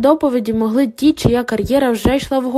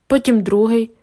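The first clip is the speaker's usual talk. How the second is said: plain reading voice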